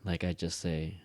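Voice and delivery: ordinary talk, nothing marked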